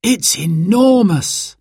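put-on high, drawn-out child's amazement; amazed child. Simon staring at the huge mountain, amazed high voice. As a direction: stretch it out high in wonder.

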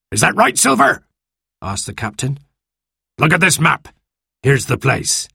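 lightly voiced commanding captain; sea captain voice.

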